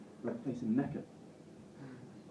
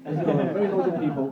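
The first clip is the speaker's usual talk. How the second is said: louder, laughing through the phrase; 2 semitones higher, 8 dB louder, faster than usual. Real, not acted. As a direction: repeat it cracking up. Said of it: "that's hilarious".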